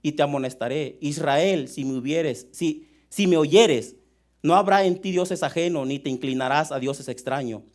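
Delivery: loud, deliberate scripture reading; lectura bíblica solemne. Acted. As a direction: proclaim the psalm to the congregation, loudly. (lectura del salmo, fuerte y pausada)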